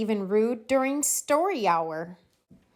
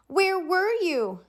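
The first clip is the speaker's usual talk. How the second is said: put-on eager kids; 10 semitones higher than usual. A narrator's reading.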